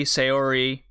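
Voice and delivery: Saori said as "say-OR-ee"; variant pronunciation. Shown as a demonstration. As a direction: open Saori with the word "say". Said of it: "heard it pronounced a number of different ways".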